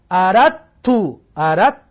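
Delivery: اردت with no d sound, a doubled t; dal merged into ta. Shown as a demonstration. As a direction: say اردت as "arattu" with the د swallowed into ت. (اردت را بدون دال، با ت مشدد بگو)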